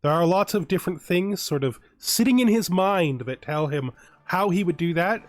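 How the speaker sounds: ordinary talk, nothing marked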